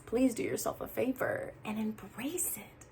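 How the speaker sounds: intimate whispered plea; hushed, earnest urging. Real, not acted. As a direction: lean in and whisper it like a secret. Urging viewer to embrace heartbreak.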